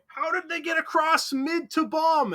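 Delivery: very high, slow mock wail; exasperated teammates. Teammates questioning the lost mid, high mock-panicked yelling. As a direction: imitate teammates in a loud, drawn-out high whine. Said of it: "I have teammates going".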